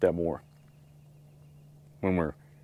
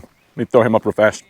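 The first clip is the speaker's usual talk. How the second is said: ordinary delivery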